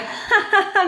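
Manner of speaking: a high hearty laugh; burst of laughter. Acted. The cloud laughing at the coquettish clod. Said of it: "Le nuage éclate de rire".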